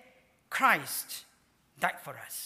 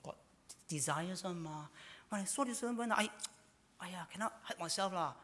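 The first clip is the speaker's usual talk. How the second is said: mock excuse; 2 semitones higher, 5 dB quieter than usual. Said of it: "He didn't say".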